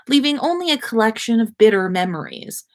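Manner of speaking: usual speaking voice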